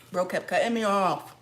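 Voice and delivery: usual speaking voice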